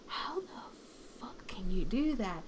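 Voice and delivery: slow, stunned whisper of disbelief. Retold or imitated. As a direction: whisper it slowly, imitating an amazed friend. Friend's stunned reaction acted out.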